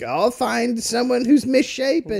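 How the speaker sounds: mock her dreamy resolve; mock Gwen. Co-host mocking Gwen's taste, high slow mock voice. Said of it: "she was like".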